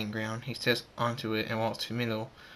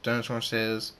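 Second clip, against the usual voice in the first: plain reading voice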